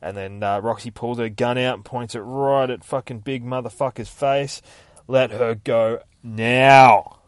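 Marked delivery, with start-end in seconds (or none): 5.1-7.2 loud, slow, deliberate order; armed command. Acted. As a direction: order him with a gun in his face. Roxy points gun at prisoner.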